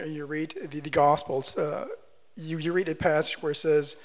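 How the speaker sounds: usual speaking voice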